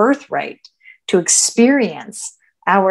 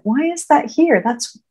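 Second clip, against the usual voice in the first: curious; 6 semitones higher than usual. Shown as a demonstration. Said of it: "I get curious".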